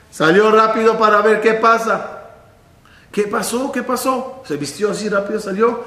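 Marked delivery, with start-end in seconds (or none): 3.1-4.4 worried. Acted. Hillel worried, dressing quickly.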